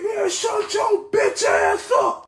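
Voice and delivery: stretched, high-pitched hammed-up scream; furious yell. College Boy yelling at the friend again, drawn-out mock scream. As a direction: imitate a man screaming in rage, dragging the words out. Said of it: "Dude yelled again".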